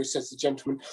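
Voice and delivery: usual speaking voice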